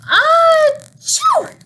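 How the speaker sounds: huge high shouted achoo; big sneeze. Acted. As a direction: blast out a giant bear sneeze. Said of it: "He blows and he sneezes".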